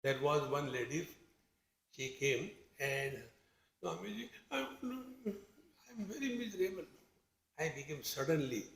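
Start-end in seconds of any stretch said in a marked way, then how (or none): 3.8-6.8 whiny misery. Retold or imitated. Lady moaning softly to Swamiji about her misery. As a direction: play the poor miserable lady fishing for sympathy.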